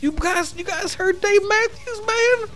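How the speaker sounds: very high, dopey hippie voice, slow at the end; hippie Sage. Pretend. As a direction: ham up a blissed-out hippie named Sage. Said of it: "My name is fucking Sage".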